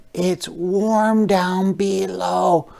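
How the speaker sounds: cosy tiny worm. Acted. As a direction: worm snug underground. Worm speaks in story.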